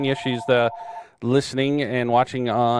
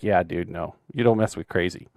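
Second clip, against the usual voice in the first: retold deadpan reply; 6 semitones lower, 5 dB quieter than usual. Retelling his deadpan reply.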